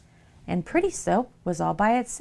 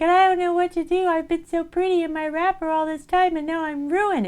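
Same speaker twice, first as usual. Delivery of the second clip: despairing pretty soap; 10 semitones higher than usual. Acted. Pretty soap laments to ugly soap, high hammed-up despair. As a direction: wail about being ruined, way up high.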